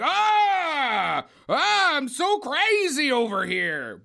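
high mock shout, then a slow, high, goofy Italian accent; crazed Italian accent caricature, high and drawn out; hammed-up crazy Italian. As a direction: ham it up like a wild Chris Farley with an Italian accent. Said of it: "with his Italian accent".

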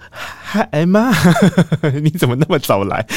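a light sing-song hello to mom that hides a nervous laugh; mildly acted breezy greeting to mom; fake innocent greeting. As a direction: play it cool while caught out. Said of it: "还是边假装".

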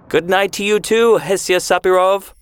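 called out high after her; calling after. Acted. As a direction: send it after her as she walks away. Calling after her as she leaves.

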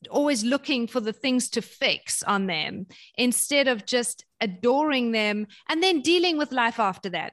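ordinary talk, nothing marked

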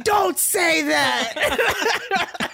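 high, loud, drawn-out laughing protest; whiny child protest. Retold or imitated. Child protesting to her teasing father, loud and drawn out. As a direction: whine it like a kid begging him to stop. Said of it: "And I was like".

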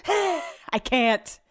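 heaving breaths, then strained protest; mock out of breath. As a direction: imitate a drama queen struggling for air. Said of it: "you're running out of breath".

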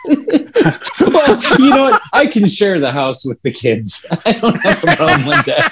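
amused reply about sharing the house; laughing. Real, not acted. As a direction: laugh while answering.

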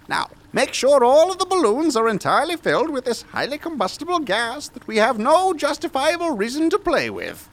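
high excited scientist; mad scientist voice. Acted. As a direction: gleefully order the gas filled. West instructs the lackey.

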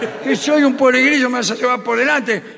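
hammed-up fury at being insulted; mock indignant yell. Father offended, yelling. (el padre grita ofendido y exagerado)

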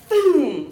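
loud, high "boom" that drops away; explosive sound-effect word; imitated impact. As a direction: hit it like a blow landing. Imitates flogger blows landing.

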